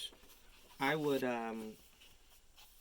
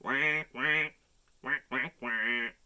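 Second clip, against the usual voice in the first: louder duck quacks; Mama Duck quacking; 7 dB louder, slower than usual. Acted. Mama Duck quacking to her ducklings, louder. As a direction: quack as a content mother duck. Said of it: "Mama Duck said".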